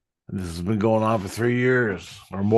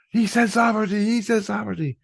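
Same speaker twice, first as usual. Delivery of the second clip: mock screaming; 11 semitones higher, faster than usual. Onlookers react to the word sovereignty, high mock screaming. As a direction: imitate a frantic crowd shrieking in a high voice. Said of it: "They're screaming".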